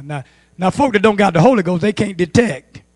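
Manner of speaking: usual speaking voice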